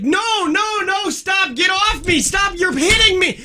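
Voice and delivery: put-on high shrieking toddler protest; little girl shrieking at her dad during a diaper change; screaming toddler. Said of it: "and she's like".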